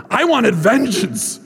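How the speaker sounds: slow, dramatic avenging growl of a line; mock-vengeful. Teacher admitting his wish for revenge, dramatically vengeful. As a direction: draw it out with hammed-up wrath. Retold anger against her forgiveness.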